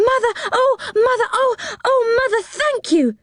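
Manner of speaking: high, excited burst of thanks; breathlessly thrilled children, pitched far up; delighted children's cry. A narrator's reading. Children thanking their mother for permission to go, overjoyed cry. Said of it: "cried the children".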